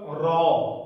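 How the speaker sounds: "را" thick and full, with a heavy trilled r. Shown as a demonstration. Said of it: "یہ را موٹا ہو کر ادا ہوگا".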